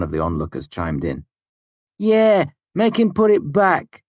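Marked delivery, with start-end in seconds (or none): 2.0-4.0 jeering. A narrator's reading. Onlooker jeering.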